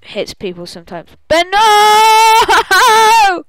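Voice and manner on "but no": high, loud, long-held wail; hammed-up cry of "no"; dramatic cry. Game master crying out over the game, dramatic wail. Player's character hit in game.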